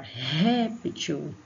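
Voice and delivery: "Réptil" with "Ré" pushed out hard and long; stress on first syllable. Shown as a demonstration. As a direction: lean on the "Ré" of "Réptil". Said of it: "exige mais força pra gente pronunciar".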